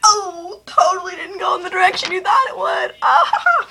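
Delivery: high and loud, laughing through it; excited loud reveal. Real, not acted. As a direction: shout the twist out, pitch way up.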